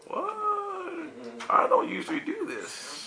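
high, startled squeak of surprise; surprised. Acted. Believer startled by an unusual prompting, high surprised. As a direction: caught off guard, voice jumps way up.

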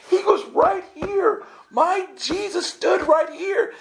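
high, drawn-out mock-hysterical shout; freaking out. Pilgrim in the dirt where Jesus stood, slow hysterical cry. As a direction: wail it slowly, overcome by revelation. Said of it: "I'm freaking out".

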